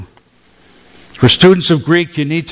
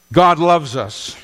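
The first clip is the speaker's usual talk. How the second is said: normal talking voice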